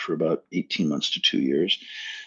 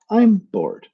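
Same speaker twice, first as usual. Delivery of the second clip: high, louder whining bored; mock whiny bored client; 13 semitones higher, 6 dB louder than usual. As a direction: act out the rich man's boredom with a whine.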